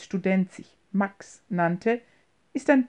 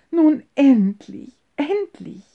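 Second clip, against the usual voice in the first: slow, high, loud relieved cry; joyful exclaim; 6 semitones higher, 6 dB louder, slower than usual. A narrator's reading. Old mother overjoyed at the couple, a slow high cry. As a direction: let the relief burst out slowly. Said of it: "rief diese".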